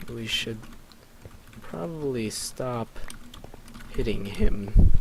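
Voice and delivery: word by word with long pauses. Real, not acted. Slowly composing the line before acting it.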